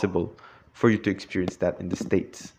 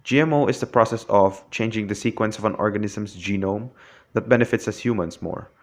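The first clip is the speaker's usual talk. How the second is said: normal talking voice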